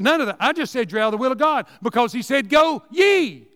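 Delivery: rising to a shout on "Go ye"; preaching shout. Real, not acted. Preacher shouts the command of God at the congregation, loud.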